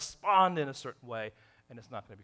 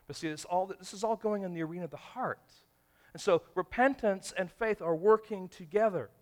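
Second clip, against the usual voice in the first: ordinary delivery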